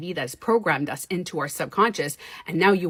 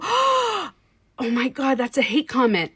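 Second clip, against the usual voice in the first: shocked, high, much higher and louder; mock outrage at a comment; mock offended; 7 semitones higher, 3 dB louder, slower than usual. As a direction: clutch pearls like an offended snowflake.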